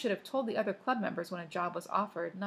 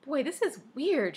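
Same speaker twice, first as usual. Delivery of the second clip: higher-pitched exasperated exclamation; 6 semitones higher, 4 dB louder than usual. A narrator's reading. Claudia fed up with wrong-number calls, exasperated. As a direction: voice a girl getting exasperated. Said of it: "Claudia was growing exasperated".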